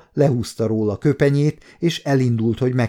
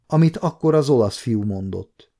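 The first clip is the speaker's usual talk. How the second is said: ordinary delivery, nothing marked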